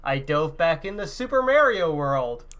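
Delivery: ordinary talk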